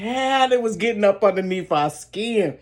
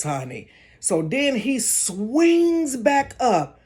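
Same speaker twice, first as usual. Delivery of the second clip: ordinary delivery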